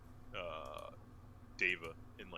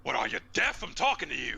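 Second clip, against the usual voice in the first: angry shout; 8 semitones higher, 11 dB louder than usual. Acted. Barbarian confronting hooded figure.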